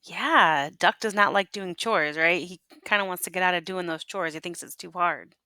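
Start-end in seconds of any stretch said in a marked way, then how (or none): none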